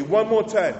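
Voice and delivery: loud and high, pushing them on; loud urging. Acted. Urging congregation louder.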